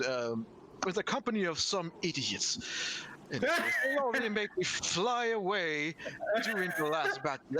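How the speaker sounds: lightly voiced haughty English accent; arrogant English accent. Professor Orb sneers at the party in an English accent. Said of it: "bit arrogant English vo-, uh, accent".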